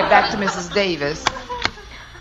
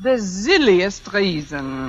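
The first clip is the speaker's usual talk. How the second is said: slow, lower mock-German drawl; put-on German accent; 4 semitones lower, slower than usual. Fake applicant setting up her excuse, slow put-on German accent. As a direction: draw the words out in a German accent. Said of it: "If I can do a decent German accent".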